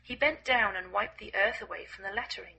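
ordinary delivery, nothing marked

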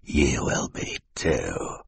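slow, whispered threat; menacing mutter. A narrator's reading. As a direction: mutter it under the breath, slowly. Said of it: "Stefano muttered to the children".

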